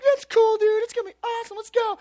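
very high mock kid voice; hammed-up little boy egging on a friend; eager kid buddy. Buddy talking him into the prank, eager high kid voice.

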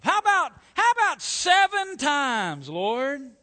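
high, loud, showy proud offer; bursting with pride. Acted. Simon Peter showing off to the Lord, proudly shouting. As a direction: shout it out to knock his socks off. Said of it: "with all the pride he could muster".

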